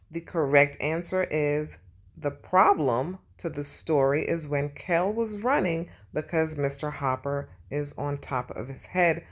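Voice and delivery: usual speaking voice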